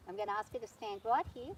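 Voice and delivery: ordinary talk, nothing marked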